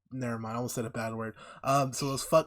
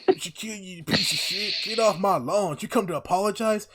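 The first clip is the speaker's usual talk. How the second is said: mock hillbilly yell; 8 semitones higher, 7 dB louder than usual. Hillbilly dad yelling at Bob Lee at his door, mock high shout.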